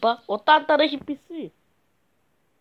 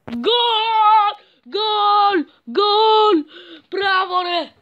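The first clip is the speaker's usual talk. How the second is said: much higher, louder shouting "Γκολ!"; goal celebration; 8 semitones higher, 6 dB louder, much slower than usual. Real, not acted. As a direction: scream the goal like a fan. (πανηγυρισμός γκολ)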